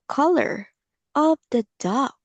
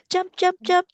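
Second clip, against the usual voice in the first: excited; 9 semitones higher than usual. Shown as a demonstration. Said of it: "can you jump".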